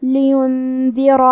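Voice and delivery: لِيُنْذِرَ slow, the ن hidden into a nasal hum before the ذ; ikhfa. Shown as a demonstration. Teacher demonstrating ikhfa to students, slow hidden nasal. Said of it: "نون ساکن کو چھپاتے ہوئے اور غنہ کے ساتھ".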